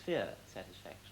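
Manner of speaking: usual speaking voice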